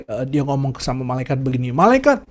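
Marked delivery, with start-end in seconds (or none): 1.7-2.3 high, pushy indignant protest; mock angry complaint. Pastor protesting to the angel in mock outrage. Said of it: "dia marah betul".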